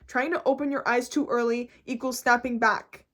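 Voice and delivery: ordinary talk, nothing marked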